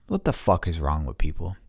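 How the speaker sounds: ordinary delivery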